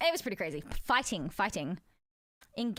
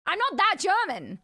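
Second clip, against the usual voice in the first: emphatic; 6 semitones higher, 7 dB louder than usual. Real, not acted. Emphatic denial.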